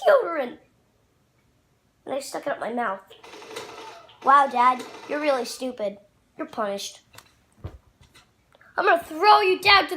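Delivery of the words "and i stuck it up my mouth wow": ordinary delivery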